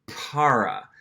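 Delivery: "para" with an American English R; English-speaker's "para", gringo R untapped; gringo accent. Shown as a demonstration. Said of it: "gringo accent or non-native accent".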